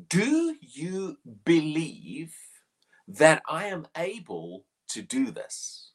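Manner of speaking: slow, drawn-out question, each word weighed; a slow, searching question to the blind men; solemn slow question. Acted. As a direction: ask it slowly as Jesus would. Quoting Jesus' question dramatically.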